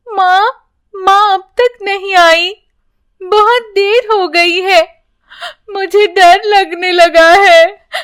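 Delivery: put-on high, slow, tearful little girl; frightened girl crying for her mother; crying child. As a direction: sob it out like a scared child. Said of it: "वो रोते हुए कहती है".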